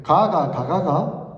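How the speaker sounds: 가가, 가가가 said slowly in Gyeongsang tones; slow, deliberate Gyeongsang riddle; Gyeongsang dialect demo. Shown as a demonstration. Preacher tests the congregation with a Gyeongsang riddle, slow Gyeongsang accent. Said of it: "제가 해볼게요".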